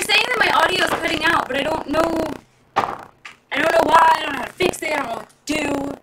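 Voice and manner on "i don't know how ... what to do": ordinary talk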